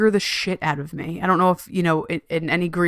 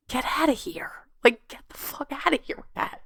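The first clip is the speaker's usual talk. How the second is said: hissed whisper, much softer; whispered brush-off; 7 semitones higher, 8 dB quieter than usual. Retold or imitated. Brushing off people's self-care advice, in a whisper. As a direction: whisper it like a private eye-roll.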